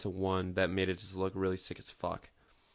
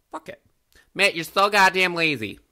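louder, higher teasing jab at himself; mock self-scolding; 8 semitones higher, 10 dB louder than usual. Streamer scolds himself for laziness, loud mock jab. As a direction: scold yourself like a nagging friend.

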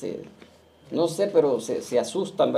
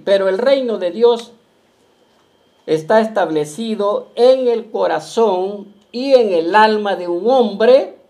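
loud, slow, higher declaiming read; preaching read; 4 semitones higher, 8 dB louder, slower than usual. Acted. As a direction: thunder the paragraph out to the flock. Preacher reads the prophet's paragraph. (proclamación lenta, fuerte y aguda)

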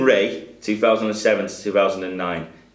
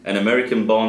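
normal talking voice

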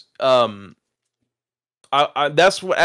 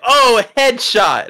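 higher, louder, slow drawn-out callout; mock gamer call; 12 semitones higher, 5 dB louder, much slower than usual. Friend mockingly crying out the hit, high and loud. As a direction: call it out like a gamer scoring a kill.

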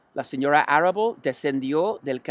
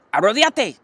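usual speaking voice

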